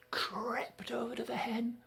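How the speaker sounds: whispering as Jack sneaks; sneaky hushed whisper; whispered narration. A narrator's reading. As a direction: whisper so the giant won't wake. Jack sneaking past sleeping giant.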